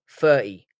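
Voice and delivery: "thirty" as "firty", t dropped; London f for th. Shown as a demonstration. Streamer letting the London out, "thirty" as "firty". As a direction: say "thirty" starting with an f. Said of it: "the London in me makes me wanna say".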